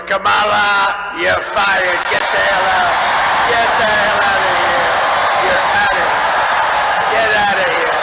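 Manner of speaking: fed-up crowd chant, shouted hard; telling Kamala off. Pretend. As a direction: yell it like you're firing her on the spot. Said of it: "You're gonna say".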